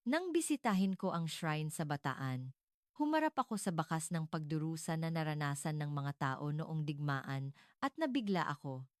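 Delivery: ordinary delivery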